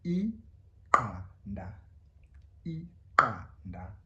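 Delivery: Iqanda said very slowly with a click on q; isiXhosa click. Shown as a demonstration. Teacher modelling Iqanda slowly, click pronounced. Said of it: "Say it with me".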